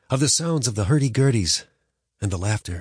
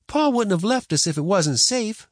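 put-on higher, earnest young boy; boy's voice; 12 semitones higher, 3 dB louder than usual. A narrator's reading. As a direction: insist like a sure boy. Boy protesting.

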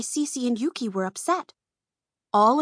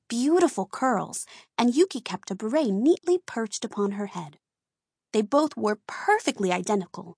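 usual speaking voice

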